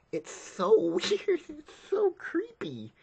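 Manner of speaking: high, slow squeal through laughter; creeped-out high giggly voice; laughing squeal. Real, not acted. Creeped out by the scene.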